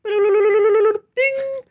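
high bright ding sound; chime ding. Pretend. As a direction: ring it out like a bell. Imitating a result chime.

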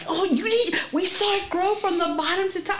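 high, breathless-with-wonder imitation; retold amazed excitement. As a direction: act out witnesses gushing over a marvel.

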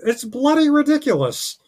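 very high, loud impression; Jordan Peterson. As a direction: exclaim it in a high, loud put-on voice. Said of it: "So he'll be like".

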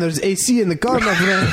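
high-pitched mock Eastern European accent; Polish or Ukrainian accent. Begging driver justifying his car, high mock Eastern European accent. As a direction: explain it in a put-on Ukrainian accent. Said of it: "Polish or Ukrainian accent".

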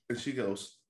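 usual speaking voice

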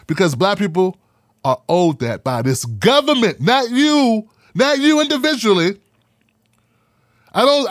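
normal talking voice

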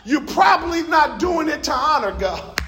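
building pulpit shout; preaching hard. Real, not acted. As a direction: preach it at full force, slowing on the key lines. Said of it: "I feel like preaching right through here".

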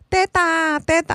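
put-on high little child's plea; imitating a toddler asking for her bottle. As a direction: beg like a small girl at bedtime.

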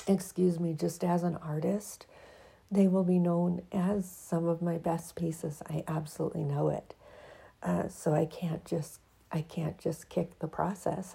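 usual speaking voice

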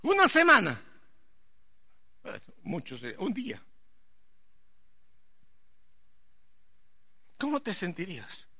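high, loud fervent preaching; full-force preaching. Acted. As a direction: preach it out to the congregation. (predicación a todo pulmón)

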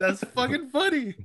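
high squeaky amused voice; amused disbelief. Retold or imitated. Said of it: "I'm just like".

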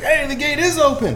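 high-pitched rallying call; shouting to the crowd. Acted. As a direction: yell to the crowd to push through. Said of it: "Can I lend to that?".

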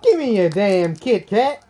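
slow, drawn-out cartoon sidekick demand; Donkey. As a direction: stretch it out as a comic animal sidekick. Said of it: "I just did Donkey there".

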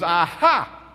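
loud, emphatic gotcha "aha"; triumphant aha. Retold or imitated. Atheist caller springs a gotcha on the pastor, triumphant. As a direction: pounce on it like a gotcha.

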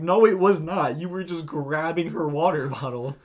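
loud, high retold protest; indignant retort. Telling the bottle grabber off, indignant. Retelling an indignant confrontation with the man.

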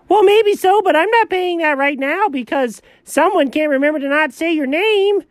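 high, heated comeback; comic high indignation; indignant arguing. Acted. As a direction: argue back in a high, worked-up voice. Arguing over blame and payment.

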